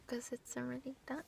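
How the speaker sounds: hushed aside; whispering. Real, not acted. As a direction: whisper it under your breath. Aside to friend.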